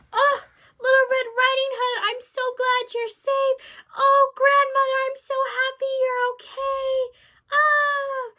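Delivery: high, loud overjoyed cries; joyful relief. Acted. The girl hugging her grandmother, high loud joy. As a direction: gush with happy relief.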